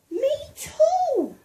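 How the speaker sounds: put-on high, loud little girl voice; eager agreement. A narrator's reading. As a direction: chime in eagerly, voice way up. Child narrator voicing herself.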